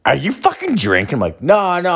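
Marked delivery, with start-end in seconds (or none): 0.0-1.1 high-pitched incredulous question; mock-scandalized wife voice; imitating disapproving wife. Wife catches him drinking, retold. 1.4-1.9 high, protesting no; hammed-up innocent protest; mock defensive denial. Acts out his defensive denial.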